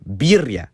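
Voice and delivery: "birria" with a dragged-out trilled R; hard rolled R. Shown as a demonstration. Teacher showing too much roll, long trilled "birria". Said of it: "It's super dragged out".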